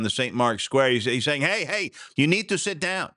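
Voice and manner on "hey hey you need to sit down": high-pitched mock Italian gondolier; hammed-up Italian accent, pitched up. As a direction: put on a high Italian boatman's voice. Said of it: "in his best Italian voice".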